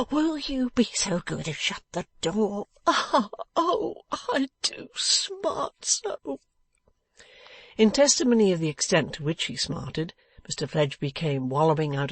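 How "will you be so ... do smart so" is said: high whine sinking into drawn-out ohs; pained moaning. A narrator's reading. Beaten man asking for a shut door, moaning. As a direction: beg politely, then wail slowly with hurt.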